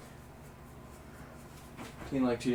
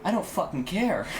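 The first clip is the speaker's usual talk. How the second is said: dismissive; 5 semitones higher, slower than usual. Retold or imitated. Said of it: "I'm like".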